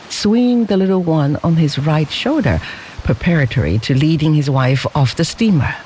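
ordinary delivery, nothing marked

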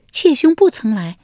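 high, coy court lady voice; lady Wenjiang. A narrator's reading. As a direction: answer sweetly as the young wife. (文姜娇声作答)

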